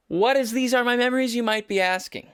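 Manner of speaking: high-pitched presenter's question; mock rhetorical question. Host voicing viewers' question about the album, hammed-up high. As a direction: lift it high, hamming up the query.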